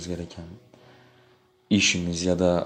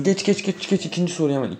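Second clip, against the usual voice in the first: excited hurrying; 8 semitones higher than usual. Real, not acted. Hurrying to next question.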